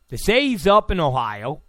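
normal talking voice

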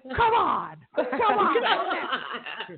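high, excited shout to the street band; cheering call. Retold or imitated. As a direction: yell it out the window like a fan. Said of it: "I almost wanted to go out there and be like".

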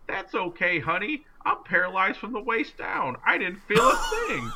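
put-on high, sweet old lady voice, laughing at the end. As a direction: chirp it like a cheerful little granny. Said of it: "And she goes".

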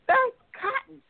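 high-pitched retold exclamation; acted-out emphatic reply, very high; emphatic explaining. Daughter explaining gift to puzzled father.

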